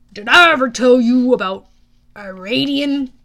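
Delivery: put-on loud, imperious old tale-teller; grand, deliberate and loud; irritable god, storytelling. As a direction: begin a pointed story.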